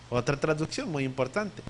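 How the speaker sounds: ordinary talk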